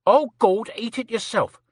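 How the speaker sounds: mock call-out. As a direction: throw it out like an annoyed man. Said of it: "He called out".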